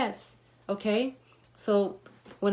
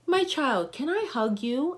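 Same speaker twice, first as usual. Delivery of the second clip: put-on higher, gentle elderly plea; tender old woman; 7 semitones higher than usual. The elderly patient asking her visitor for a hug, higher. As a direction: lightly voice a tender old lady.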